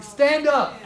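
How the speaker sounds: loud, abrupt retold command; shouted order. Speaker retelling an order to the audience, shouted. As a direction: shout it at a seated crowd.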